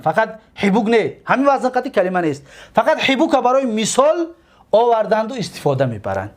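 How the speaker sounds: ordinary talk, nothing marked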